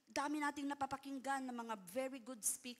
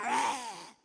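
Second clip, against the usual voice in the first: mock tiger roar; 6 dB louder than usual. Tame tiger roar imitated.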